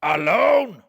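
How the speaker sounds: the wronged creature, bitter and threatening, slow on the warnings; vengeful creature. Acted. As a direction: speak as a spurned monster promising revenge. Creature threatens his maker.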